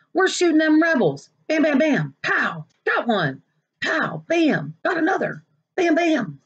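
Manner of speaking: put-on a boy lost in his game, blasting away; excited kid at war play. Kid playing war with gun noises.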